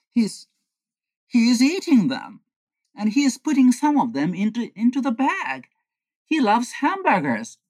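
high-pitched, amazed discovery; astonished report. Acted. Friend peeking in reports to John, astonished.

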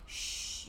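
imitated dad's shush; a long, voiceless "shh". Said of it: "my dad has been like".

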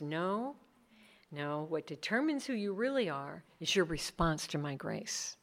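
Jesus speaking softly to the woman; gentle whisper. Acted. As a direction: reassure her in a whisper.

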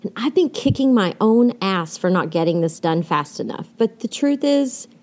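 usual speaking voice

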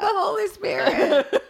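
high, slow hammed-up piety; exaggerated churchy reply; mock-pious answer. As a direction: draw it out high and loud, laughing. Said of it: "don't be all like".

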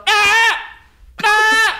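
very high, loud parrot shriek; shrill bird squawk of protest; parrot screech. Retold or imitated. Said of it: "it would just go".

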